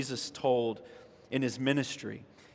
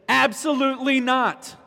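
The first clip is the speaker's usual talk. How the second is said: ABSOLUTELY NOT, much higher and louder; emphatic denial; 9 semitones higher, 6 dB louder than usual. Retold or imitated. Paul answers whether God rejected his people, ABSOLUTELY NOT in emphatic denial. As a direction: hit every word of "Absolutely not" hard and high. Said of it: "he says it in the most emphatic way possible".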